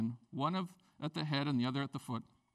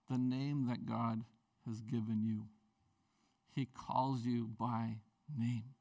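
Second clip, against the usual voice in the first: slow, soft and lower, lingering on each word; tender and slow; 7 semitones lower, 3 dB quieter, slower than usual. Real, not acted. Preacher to the congregation, slow and tender. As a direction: let it land gently, like a promise.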